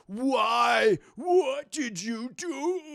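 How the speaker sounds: high, loud, drawn-out mock wail; hammed-up villain's cry of despair; anguished wail. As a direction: howl it slowly in cartoon anguish. Acts villain wailing at his foe.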